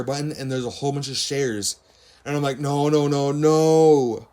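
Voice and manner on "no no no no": loud, rising run of panicked no's; upset protest. Retold or imitated. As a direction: protest louder and louder, last word held. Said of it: "So I'm really upset now".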